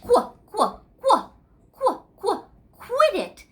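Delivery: high, loud monkey quahs; monkey chatter. Acted. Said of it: "he was copying, saying".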